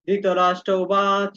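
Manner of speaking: very slow, measured verse reading; shloka recitation. Shown as a demonstration. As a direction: recite each word slowly for students. Starts reciting verse again. (ধীরে শ্লোক আবৃত্তি)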